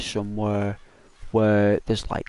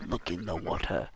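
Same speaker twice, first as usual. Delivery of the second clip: Rafiki voice; 5 semitones lower, 9 dB quieter than usual. Retold or imitated. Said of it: "Rafiki the monkey says".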